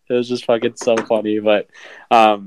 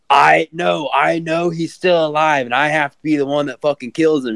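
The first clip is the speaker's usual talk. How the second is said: obsessed doctor; 5 semitones higher than usual. Retold or imitated. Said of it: "And he's still like".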